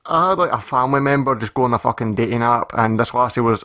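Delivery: ordinary talk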